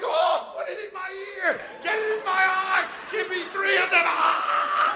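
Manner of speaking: very high, hammed-up squeal of delight; mock ecstatic shrieking. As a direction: imitate over-the-top joy, screeching upward. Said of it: "They're so happy".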